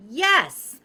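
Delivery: high, loud triumphant yes; delighted exclamation; excited yes. Real, not acted. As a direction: cheer it like a small win. Excited about the markers.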